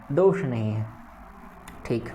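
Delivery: normal talking voice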